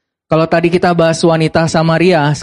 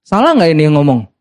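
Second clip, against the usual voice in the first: ordinary delivery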